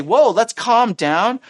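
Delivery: slow, high-pitched order to settle; acted urgent appeal; commanding. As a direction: rein them in with a drawn-out command. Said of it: "giving them a command".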